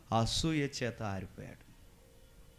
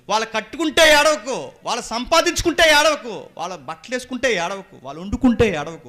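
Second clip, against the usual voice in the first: loud, high preaching, easing slightly; full-force exhortation; 9 semitones higher, 14 dB louder than usual. Acted. Preacher telling listeners not to envy neighbours, loudly exhorting. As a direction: drive each repeated warning home.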